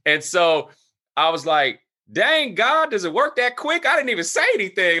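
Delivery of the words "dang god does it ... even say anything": high-pitched retold astonishment; amazed. Man reacts to God's quick answer, amazed. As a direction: marvel at how fast it came true.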